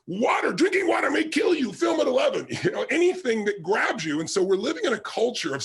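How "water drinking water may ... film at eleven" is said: shouted, much higher alarmist news teaser; breathless mock newscaster shouting a scare. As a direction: ham up a scary TV headline.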